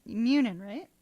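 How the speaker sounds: ordinary talk, nothing marked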